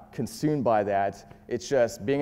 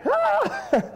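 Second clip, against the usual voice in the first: very high imitated shriek of fright; mock startled shriek; 3 dB louder than usual. Imitating his date startled from behind, high shriek. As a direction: squeal like she jumped out of her skin. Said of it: "She's like".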